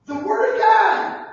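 shouted high and slow, the Word of God; emphatic proclamation. Real, not acted. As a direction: proclaim it with full force. Preacher answers his own question.

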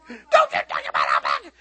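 hammed-up shouted warning; exaggerated scolding shout; mock furious daughter. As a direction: imitate an outraged daughter, loud and distorted. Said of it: "about to kill me".

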